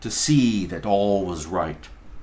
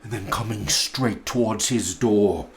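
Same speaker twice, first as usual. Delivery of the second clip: ordinary delivery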